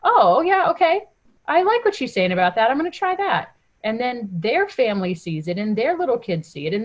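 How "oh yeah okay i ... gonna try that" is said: curious friend voice. Retold or imitated.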